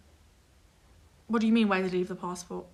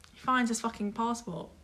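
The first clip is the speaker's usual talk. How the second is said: much softer, deadpan punchline; understated reveal; 9 dB quieter than usual. Real, not acted. Friend revealing the found passport, quietly fed up. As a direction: drop the volume for the reveal.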